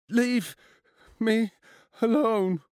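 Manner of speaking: high, halting frightened plea; quivering. A narrator's reading. Cornered boy begs bullies to leave him, quivering. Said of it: "Morpeth's voice quivered".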